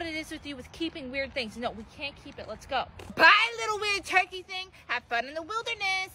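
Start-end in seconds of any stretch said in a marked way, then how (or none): none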